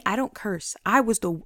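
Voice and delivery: usual speaking voice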